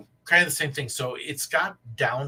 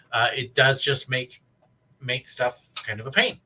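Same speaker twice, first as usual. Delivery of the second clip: normal talking voice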